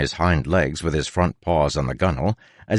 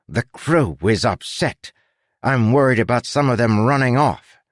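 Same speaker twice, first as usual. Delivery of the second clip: stately captain voice, raised in pitch; 6 semitones higher than usual. A narrator's reading. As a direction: play a pompous captain, measured. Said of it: "said in his captain voice".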